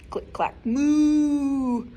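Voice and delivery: drawn-out click clack moo; slow sound refrain. Acted. Librarian reads the refrain slowly to the kids. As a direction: stretch it out like a chant.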